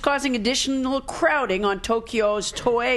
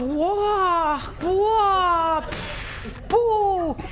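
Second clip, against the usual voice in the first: wailing wah; 6 semitones higher, much slower than usual. Retold or imitated. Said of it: "When she goes".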